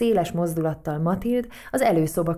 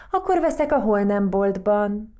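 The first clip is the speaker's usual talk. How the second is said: louder parting call, lightly acted; mild shout; 3 semitones higher, 6 dB louder than usual. A narrator's reading. Margaréta calling back to Matild on her way out, mild shout. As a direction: call it back over your shoulder, mildly. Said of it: "kiáltotta Margaréta".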